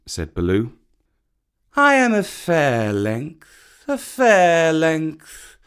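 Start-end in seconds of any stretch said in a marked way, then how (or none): 1.8-5.6 slow, lightly proud drawl; a little pride. A narrator's reading. Kaa boasting of his length, a touch proud. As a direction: savour your own size. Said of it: "said Kaa with a little pride".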